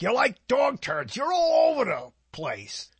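high, drawn-out New Yorker voice; old-time New York saying. Retold or imitated. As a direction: put on a high-pitched New York character. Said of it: "They used to say in New York".

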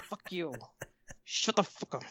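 very soft, almost whispered retort; muttered curse. Retold or imitated. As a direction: swear under your breath at the agent. Muttered retort to waiting agent.

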